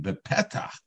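normal talking voice

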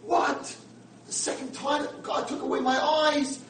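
high, loud anguished cry; anguished scream. Retold or imitated. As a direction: cry out in grief. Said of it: "Friedman starts screaming".

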